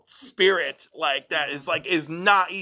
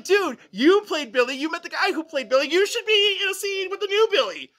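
indignant fan; 10 semitones higher, 4 dB louder than usual. Retold or imitated. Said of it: "I'm like".

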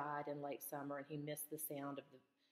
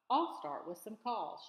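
eager; 5 semitones higher, 7 dB louder than usual. A narrator's reading. Grandmother delighted, eager.

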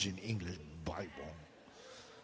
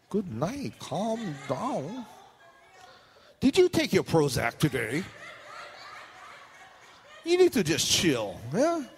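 teasing calm down; 5 semitones higher, slower than usual. Pretend. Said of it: "mock calming".

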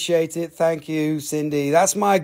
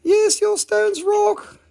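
silly high voice; 12 semitones higher than usual. Pretend. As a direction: play a goofy little character.

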